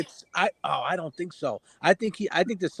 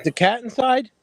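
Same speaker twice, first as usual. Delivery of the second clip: calling out; 6 semitones higher, 4 dB louder than usual. Real, not acted. Calling to Michelle about cat.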